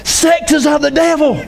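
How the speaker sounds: high, mock hellfire preacher; preacher's decree. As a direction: ham up the preacher condemning it. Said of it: "hear those preachers like".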